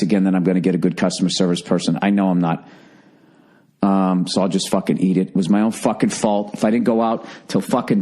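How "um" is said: ordinary talk, nothing marked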